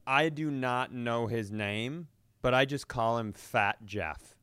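ordinary talk

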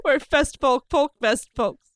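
real laughter breaking up the words; cracking up. Real, not acted. Comic finishing Fest Folk tongue twister, helpless with laughter. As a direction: struggle to get through it, laughing.

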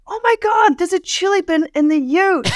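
high, thrilled cry of discovery; excited yell. Retold or imitated. Hiker yelling to friends about a cooler. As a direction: shout it like you've struck gold. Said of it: "I just remember yelling out".

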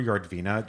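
"Yardvina" with a sounded R; name with an R. Real, not acted. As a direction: say "Yardvina" with a clear American R after the first vowel. Pronounces the name with an R.

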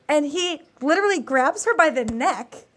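normal talking voice

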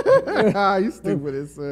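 slow words through laughter; laughing. Real, not acted. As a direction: laugh while saying it. Laughing at a joke.

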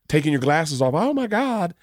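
high, excited mock fluster, rising to a put-on voice; hammed-up, flustered, feigning surprise; mocking impression of Leanne.

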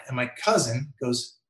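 ordinary talk, nothing marked

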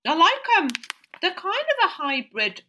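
high and loud delighted tasting; thrilled by the first bite; excited. Real, not acted. First taste of the cheese.

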